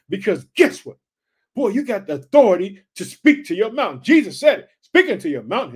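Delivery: loud, punchy exhortation; full-force preaching. Real, not acted. Preacher telling believers they have authority, loud forceful preaching. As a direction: drive it home to the congregation.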